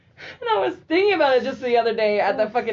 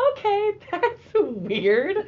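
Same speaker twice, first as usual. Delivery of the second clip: amused puzzlement; 9 semitones higher, much slower than usual. Retold or imitated. Quoted, slow and high.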